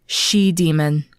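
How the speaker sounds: slow, heavy and low. Acted. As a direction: let each word sink, slowly. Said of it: "She thought to herself as tears welled".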